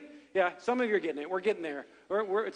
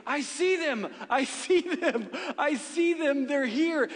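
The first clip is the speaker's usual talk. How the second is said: higher-pitched imitation of a thrilled little boy, not a full scream; mock excited screaming; 6 semitones higher, slower than usual. Uncle imitating his nephew spotting his cousins' car, mock excited screaming. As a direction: yelp it like a kid losing his mind, held back. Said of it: "he starts screaming".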